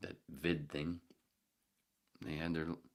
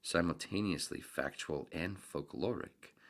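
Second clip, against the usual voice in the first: slow, savoring each word; slow, deliberate reading; slower than usual. Acted. Reader lingering over the essay, slow and deliberate. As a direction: read it slowly, relishing the words. Reads passage slowly.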